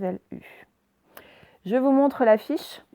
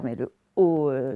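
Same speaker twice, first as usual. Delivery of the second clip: normal talking voice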